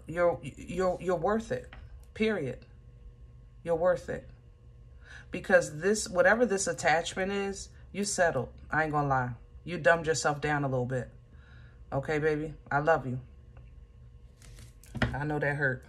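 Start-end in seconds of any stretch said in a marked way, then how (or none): 0.1-4.3 tender reassurance. Real, not acted. 7.9-15.8 gentle consoling. Real, not acted. Gentle hard truth to Leo.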